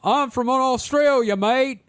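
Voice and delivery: high, slow, bad Aussie accent; terrible Australian accent. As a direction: overdo a fake Australian accent. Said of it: "That's terrible".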